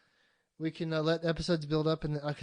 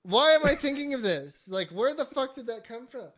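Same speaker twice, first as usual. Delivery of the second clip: high, indignant re-enacted rant; retold angry bafflement; 9 semitones higher, 7 dB louder than usual. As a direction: demand answers in mock outrage. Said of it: "I was, like, angry".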